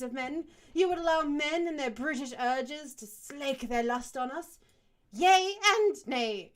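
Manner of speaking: loud anguished plea; impassioned protest. Acted. Inga character protests.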